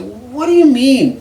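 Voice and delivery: high, loud and slow; shocked disbelief. Retold or imitated. Friend says the money is gone, high, loud, stunned. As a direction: stretch it out, stunned.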